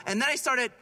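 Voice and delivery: ordinary talk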